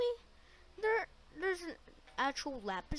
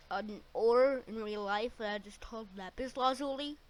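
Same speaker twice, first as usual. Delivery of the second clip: normal talking voice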